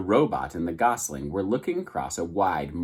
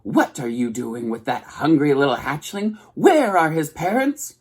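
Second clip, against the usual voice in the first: squawking goose; 6 semitones higher, 4 dB louder than usual. A narrator's reading. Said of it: "squawking to the robot".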